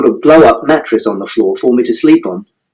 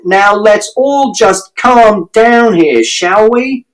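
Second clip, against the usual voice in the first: shouted plea for calm; 9 semitones higher, much slower than usual. A narrator's reading. Mister Shears shouting for calm between the parents. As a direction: try to settle both of them. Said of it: "And Mister Shears shouted".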